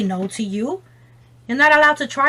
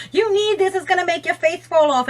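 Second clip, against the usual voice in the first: salesy product voice; 7 semitones higher than usual. Retold or imitated.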